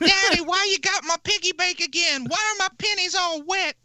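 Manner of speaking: put-on high, loud little kid; a whiny kid asking daddy; child voice. Voicing a child.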